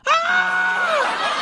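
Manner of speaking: a sudden shrieking scream, very high; startled scream. Retold or imitated. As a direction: shriek in shock at the sight of the leg. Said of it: "my sister-in-law screamed at that".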